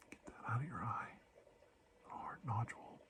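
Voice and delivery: soft unvoiced whisper. Doctor about to remove something from the eye, whisper. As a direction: hush it as you lean in.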